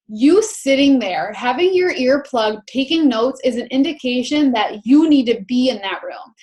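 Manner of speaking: slow, deliberate and weighty; emphatic pronouncement. Retold or imitated. Retelling a mentor telling the excluded guest she belongs, slow and emphatic. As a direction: spell it out slowly, leaning on each phrase.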